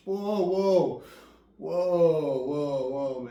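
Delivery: high, loud drawn-out whoas that settle down; retold alarm at the sponsor's words, loud and high, easing off; startled protest. Retold reaction to harsh sponsor.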